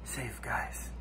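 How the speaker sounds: hushed, urgent whisper. Acted. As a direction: whisper as if still hiding. Whispering after fleeing a bear.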